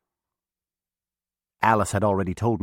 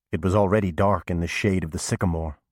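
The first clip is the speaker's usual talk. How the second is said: ordinary delivery, nothing marked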